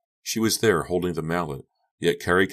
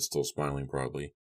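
usual speaking voice

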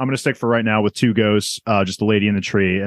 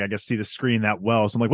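ordinary talk

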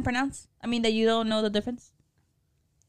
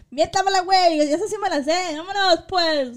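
playful challenge; 7 semitones higher, 3 dB louder than usual. Real, not acted. Playful defiant comeback.

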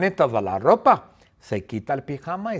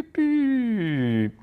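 high electronic beep sound; alarm beep; 9 semitones higher than usual. Acted. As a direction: mimic the alarm clock beeping. Alarm clock beeping imitation. (pitido agudo de despertador)

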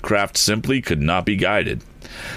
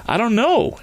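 anxious. A narrator's reading. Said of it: "answered Tom".

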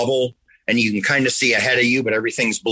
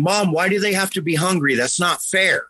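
put-on child's voice; 7 semitones higher than usual. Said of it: "pointing at the T V going".

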